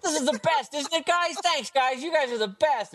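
put-on high eager kid voice; needy kid voice. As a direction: gush like a lonely kid. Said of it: "you're like".

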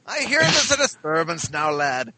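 put-on rough stab at an Irish accent; attempted Irish cop. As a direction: play a cop with a makeshift Irish lilt. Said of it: "my best attempt at an Irish cop".

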